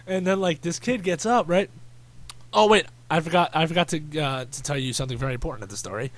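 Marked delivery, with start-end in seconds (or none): none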